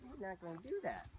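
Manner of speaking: high, soft telling-off; high-pitched refusal. Real, not acted. Trainer to a balking horse, high-pitched refusal.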